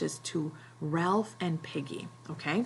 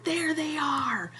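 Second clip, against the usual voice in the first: much higher, slow pointed line; grim spotting; 10 semitones higher, slower than usual. Retold or imitated. Ralph spotting the hunters, higher and slow. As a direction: Ralph catching sight of the hunters. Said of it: "And he's like".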